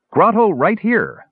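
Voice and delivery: high, triumphant crow of a dim dwarf. A narrator's reading. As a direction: announce it with a sudden proud crow. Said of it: "Numskull crowed unexpectedly".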